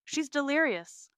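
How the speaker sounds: high, loud alarm; urgent report. A narrator's reading. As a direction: alert the team in an emergency. Nurse's urgent emergency report.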